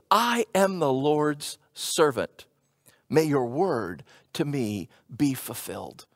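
slow, soft, low surrender; humble and gentle. Retold or imitated. Mary humbly answers the angel, soft and slow. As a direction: offer yourself up quietly and humbly. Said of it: "a hundred and eighty degrees away from that".

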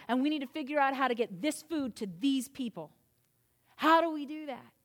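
loud, high sermon delivery, driving every line; preaching at full force. Acted. Pastor rousing the congregation about anger, loud and high.

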